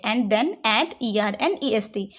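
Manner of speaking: slow, deliberate rule reading; slow dictation read. Shown as a demonstration. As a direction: read the rule out slowly for students to write. Reads rule slowly as dictation. (धीरे-धीरे नियम पढ़ना)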